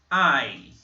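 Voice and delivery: I said as eye, loud; teacher demonstrating the letter; letter name demo. Shown as a demonstration. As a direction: model the English letter I. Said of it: "que em inglês nós falamos".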